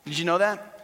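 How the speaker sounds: ordinary talk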